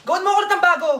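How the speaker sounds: loud, irate command; angry order. Acted. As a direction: bark the order at the maid. Employer orders maid angrily.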